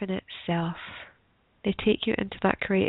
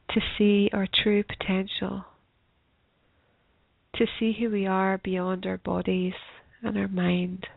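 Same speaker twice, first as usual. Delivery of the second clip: guided meditation. Acted. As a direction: ease them gently into stillness.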